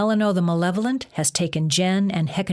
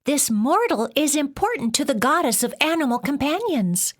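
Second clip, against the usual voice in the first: much higher little weasel voice; 9 semitones higher than usual. A narrator's reading. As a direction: plead quickly as a small weasel. Weasel pleading for Jen.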